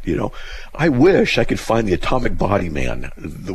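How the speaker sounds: ordinary delivery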